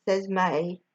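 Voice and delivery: ordinary delivery, nothing marked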